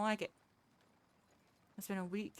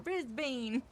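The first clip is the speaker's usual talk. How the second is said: higher, louder try at an Australian Brisbane; Aussie accent attempt; 7 semitones higher, 5 dB louder than usual. Shown as a demonstration. Streamer tries an Aussie Brisbane for chat, laughing at herself. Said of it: "I can't do that".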